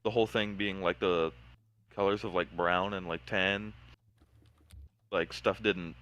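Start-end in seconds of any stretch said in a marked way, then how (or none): none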